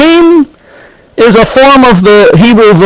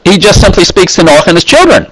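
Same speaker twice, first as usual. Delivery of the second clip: quick throwaway reading; casual fast read, no weight on children; ordinary speech; faster than usual. Shown as a demonstration. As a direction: rattle off "he just simply speaks to Noah and his children" fast. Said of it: "in ordinary speech".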